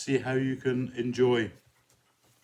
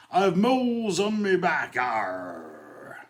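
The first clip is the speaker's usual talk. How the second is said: higher, louder pirate growl ending in arr; 7 semitones higher, 5 dB louder, much slower than usual. Acted. Pirate telling the doctor his complaint, hammed-up pirate voice. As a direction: ham up the pirate. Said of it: "A pirate goes to the doctor and says".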